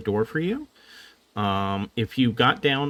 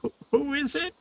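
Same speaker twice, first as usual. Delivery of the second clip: high, timid little voice; high timid tiny creature; small creature voice; faster than usual. Acted. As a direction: squeak it out like a scared tiny being. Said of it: "he says".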